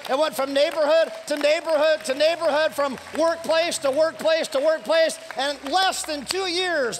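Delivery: high, loud rousing preacher's cry; full-force preaching climax. Acted. Pastor urging the church to share their faith, preaching at full force. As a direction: drive the church to a fired-up finish.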